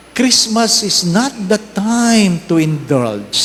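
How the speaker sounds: slow, measured sermon delivery; solemn homily. Acted. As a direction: preach it slowly and deliberately. Preaching opening, slow formal.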